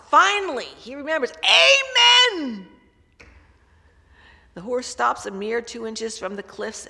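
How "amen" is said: loud, high desperate cry; desperate shout. Retold or imitated. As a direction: scream it as a last hope. Remembers stop word at cliff.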